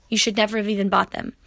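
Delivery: ordinary talk, nothing marked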